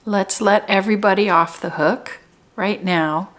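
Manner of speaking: spacious, measured meditation leading; guided meditation. Real, not acted. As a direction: guide them gently into their center, unhurried. Leads guided meditation, slow with pauses.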